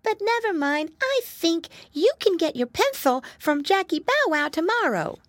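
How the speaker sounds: put-on very high little-boy piglet; high cartoonish storybook pig; piglet brother. Flop consoles his brother about the pencil, high piglet voice.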